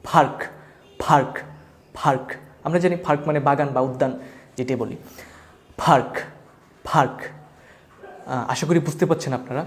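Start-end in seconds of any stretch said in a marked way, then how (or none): 0.1-2.3 "ফার্ক" with a puff of air on the p; aspirated P. Shown as a demonstration. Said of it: "এটাকে বলবো হচ্ছে ফার্ক". 5.8-7.2 "ফার্ক" with a puff of air on the p; aspirated P. Shown as a demonstration. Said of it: "এটাকে বলবো হচ্ছে ফার্ক".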